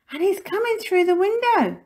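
put-on high, loud child's yell; excited shout. A narrator's reading. Jinx reporting the burglar's entry, shouted high and excited. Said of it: "shouted Jinx".

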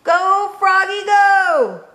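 high, rousing cheer; friends' shout. A narrator's reading. Friends cheering Froggy on, high shout. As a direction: shout it out high, stretching the last word. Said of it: "Froggy's friends shouted".

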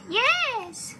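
high, drawn-out yes; gleeful high-pitched agreement; delighted witch. A narrator's reading. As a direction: squeal the yes as a pleased witch.

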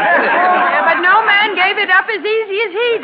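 high, loud joking shout over the crowd; teasing shout. Acted.

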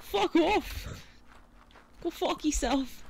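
high mock British insult; British accent. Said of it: "You even said that in a British accent".

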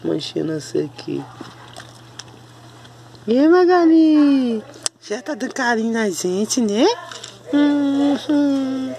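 high, sing-song cooing at the dogs; sweet high pet voice. Real, not acted.

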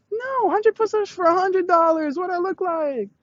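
high-pitched playful outrage; mock indignation. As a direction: protest in a high voice, only kidding. Joking outrage, then "just kidding.

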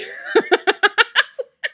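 high burst of real laughter; giggling fit. Real, not acted. Laughing at the story.

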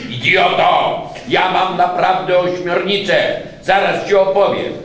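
loud high insisting; boy insisting his story is true; frustrated boy. A narrator's reading. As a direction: snap at him, annoyed. Jacek insisting angrily.

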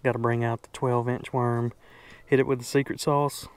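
ordinary talk

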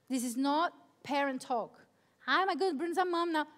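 ordinary talk

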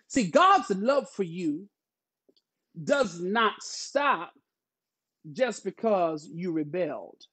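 slow preacher's emphasis with weighty pauses; preaching. Real, not acted. Pastor assuring the congregation of God's love, slow preaching. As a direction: preach it phrase by phrase, slowly.

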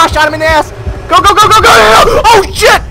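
high, loud hyped shouting; excited yelling. Real, not acted. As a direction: yell in a rush of excitement.

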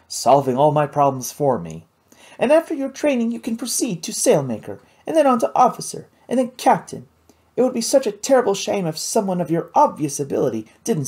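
put-on a lively, clever girl sharing her knowledge; Kate's voice. Kate plans his career.

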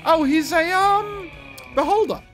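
high, loud excited exclamation; excited surprise. Real, not acted. Surprised at new boss.

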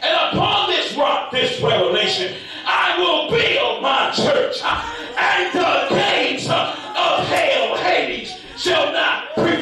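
high, shouted scripture with drawn-out words; full-force preaching. Real, not acted. Preacher declaring Jesus's promise to Peter, full-force. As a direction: build to a slow, shouted pulpit climax.

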